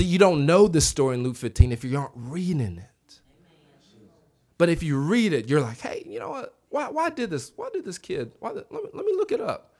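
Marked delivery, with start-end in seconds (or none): 5.8-9.7 high, soft pondering to oneself; curious reader. Acted. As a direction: wonder aloud in a light, high voice. Acting a curious Bible reader.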